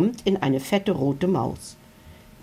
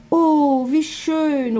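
high, louder delighted gasp of wonder; 10 semitones higher, 3 dB louder, much slower than usual. A narrator's reading. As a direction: marvel at the fireworks, high. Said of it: "rufen die Dinos begeistert". (hoch und begeistert gerufen)

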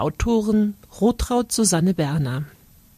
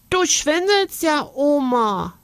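put-on child teasing back; 8 semitones higher than usual. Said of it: "sagt Karlchen".

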